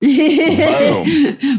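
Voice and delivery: high, loud laughing boom; a loud, high, laughing sound effect of the ship's shot. Real, not acted. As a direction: blurt it like an explosion. Punchline of the cancelled scene.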